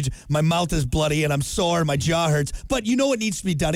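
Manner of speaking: normal talking voice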